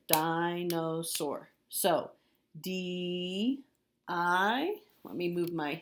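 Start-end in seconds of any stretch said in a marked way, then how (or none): 0.1-1.5 very slow, three syllables drawn out. Shown as a demonstration. Teacher models dinosaur for third graders, very slowly. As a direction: sound out each syllable slowly. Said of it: "Three syllables here".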